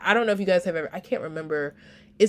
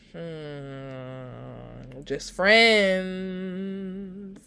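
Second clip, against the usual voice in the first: knowing hum then a teasing just friends; imply you don't buy it; mock-skeptical, drawn out; sly insinuation; much slower than usual. Mocking the quoted denial.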